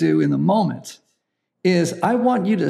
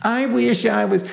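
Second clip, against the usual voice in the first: whiny voice, laughing; 6 semitones higher than usual. Shown as a demonstration. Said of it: "use a whiny voice".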